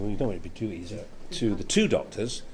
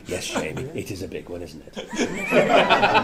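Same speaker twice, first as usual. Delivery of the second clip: Doctor voice; 3 semitones lower, 3 dB louder, much slower than usual. Retold or imitated. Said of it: "And I go".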